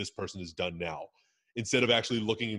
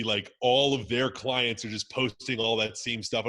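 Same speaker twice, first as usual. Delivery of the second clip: usual speaking voice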